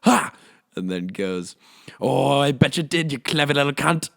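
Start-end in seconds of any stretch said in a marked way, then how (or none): 0.0-0.3 a sharp, very high cackle; mock cackle. Australian golfer laughing at the narrator, high loud cackle. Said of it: "he just fucking cackled and just goes". 2.0-4.1 loud, high Aussie teasing; mock him in a loud, high Australian voice; Australian accent. Australian golfer teasing the narrator, loud high Aussie voice.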